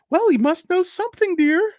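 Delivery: very high falsetto voice; mock spouse. Mock spouse answering the wife in a high falsetto. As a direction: coo it back in falsetto.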